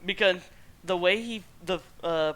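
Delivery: usual speaking voice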